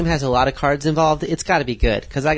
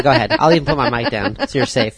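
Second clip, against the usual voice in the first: ordinary talk, nothing marked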